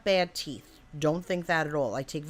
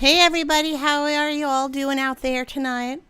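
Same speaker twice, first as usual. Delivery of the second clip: put-on higher-pitched, not-so-good Southern accent; attempted Southern accent; 8 semitones higher, 5 dB louder than usual. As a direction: greet the crowd in a dodgy Southern twang. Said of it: "That's not a good Southern accent".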